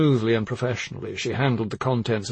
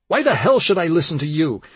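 raised, higher-pitched indignant challenge; angry defiance; 6 semitones higher, 5 dB louder than usual. A narrator's reading. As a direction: throw it back in angry defiance. Dan defies the doctor in panic.

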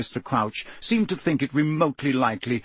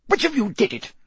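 high, sharp demand; snapped accusation; 9 semitones higher than usual. A narrator's reading. Crouch questions the three children, snapped accusation. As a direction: snap the question at suspects. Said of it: "he snapped".

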